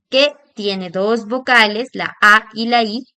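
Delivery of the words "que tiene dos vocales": ordinary talk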